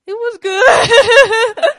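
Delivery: loud, high wail drawn out slowly; hammed-up tearful howl breaking into laughter; mock crying. Woman replays telling roommate about the date, mock crying. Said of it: "I'm, I'm crying".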